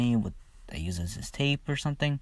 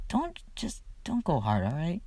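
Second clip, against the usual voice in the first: much higher, softer put-on girl voice; Mirko's voice; 7 semitones higher, 5 dB quieter than usual. Mirko telling Izuku not to go hard, high soft put-on voice. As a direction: pitch it up into her flustered voice.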